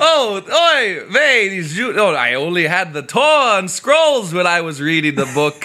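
hammed-up Yiddish accent; a hint of an old rabbi voice.

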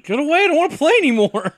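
mock panicky voice, rushing off; high-pitched quitting cry. Acting out a movie character fleeing.

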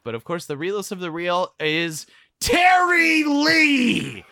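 slow, shouted sponsor hype; hype announcer. Acted. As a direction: boom it out like a ring announcer.

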